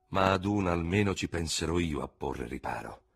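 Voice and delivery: ordinary delivery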